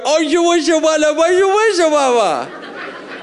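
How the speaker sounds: put-on high, playful cooing at a baby; baby talk. Parent cooing baby talk to their infant. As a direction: coo nonsense up high to a baby. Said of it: "and you go".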